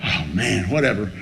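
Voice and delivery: low, muted brush-off; resigned dismissal. Retold or imitated. Newcomer hears he's gonna make it, resigned dismissal. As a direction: let it drop low, unimpressed.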